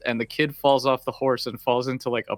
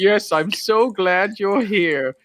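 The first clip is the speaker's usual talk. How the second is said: slow, stretched, sugary voice; hammed-up heartfelt; mock sappy sincerity; 5 semitones higher, 4 dB louder, much slower than usual. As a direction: drag it out like a sentimental film line. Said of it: "And they're like".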